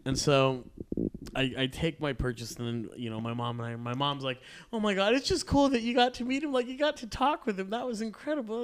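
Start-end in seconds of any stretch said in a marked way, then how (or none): 4.7-8.6 much higher, gushing mother voice; gushing mom. Retold or imitated. Mom gushing to her son about meeting the director. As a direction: gush like a thrilled mom.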